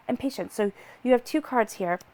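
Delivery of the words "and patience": normal talking voice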